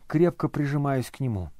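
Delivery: usual speaking voice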